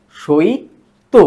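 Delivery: সইত stretched very slowly, the i sounded before t; drawn-out dialect word. Shown as a demonstration. Teacher showing students the dialect form, very slow. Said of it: "আগে উচ্চারণ করছি আমরা আগে".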